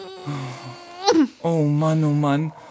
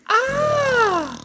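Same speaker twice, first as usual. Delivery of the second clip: high delighted ah. Acted. Newcomer understanding.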